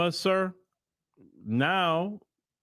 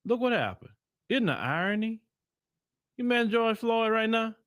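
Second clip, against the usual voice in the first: ordinary talk, nothing marked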